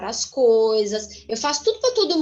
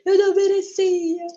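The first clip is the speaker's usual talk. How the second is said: tearful admission; 7 semitones higher, slower than usual. Retold or imitated. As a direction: relive the moment you broke down, a mild sob in it. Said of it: "Aí eu comecei a chorar".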